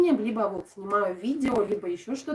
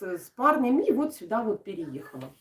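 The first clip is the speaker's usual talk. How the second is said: usual speaking voice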